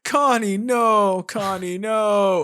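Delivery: loud, high hammed-up wail of a name; mock anguished cry. Harry wailing as wife burns, mock anguish. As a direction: overplay the grief, calling out. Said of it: "he's like".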